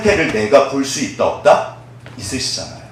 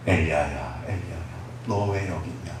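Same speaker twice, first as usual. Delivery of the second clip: gentle voice of God; 10 semitones lower, 12 dB quieter than usual. Acted. God tenderly asking Elijah why he is in the cave. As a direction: coax him out of the cave softly. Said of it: "부드러운 목소리로, 온화한 목소리로".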